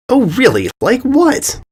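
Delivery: high sneering filly voice; mocking. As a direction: sneer at the warning. Bully mocks the warning.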